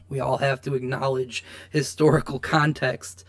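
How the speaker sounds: ordinary delivery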